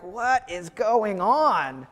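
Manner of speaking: slow, drawn-out bewilderment; baffled. Retold or imitated. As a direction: ask it like you can't believe your eyes. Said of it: "you read these verses and you're like".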